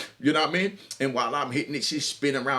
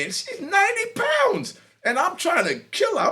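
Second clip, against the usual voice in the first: amazed. Real, not acted. Amazed at tiny woman.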